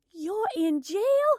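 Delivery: high, loud, drawn-out shriek of disbelief; yelled. A narrator's reading. Morgan to her sister on the phone, yelling in shock. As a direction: yell it, rising on "jail". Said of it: "She yelled the word out".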